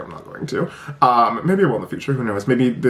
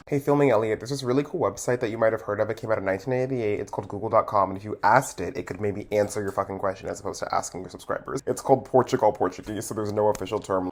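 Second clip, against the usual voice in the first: soft, low sarcastic correction; quiet, sarcastic insert to yourself; deadpan editor's aside; 3 semitones lower, 6 dB quieter than usual. Acted. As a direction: drily scold your past self. Later self scolds filming self.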